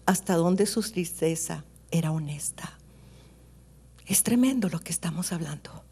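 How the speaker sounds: ordinary talk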